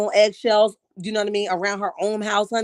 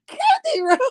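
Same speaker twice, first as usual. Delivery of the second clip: high laughing plea through giggles; real laughter breaking through; 11 semitones higher, 3 dB louder, slower than usual. Real, not acted.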